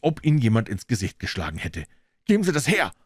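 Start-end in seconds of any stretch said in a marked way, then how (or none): 2.2-2.9 high, agitated command; urgent, high-pitched outburst without much volume; frantic demand. A narrator's reading. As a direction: cry out beside yourself, pitch jumping up. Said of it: "rief er außer sich".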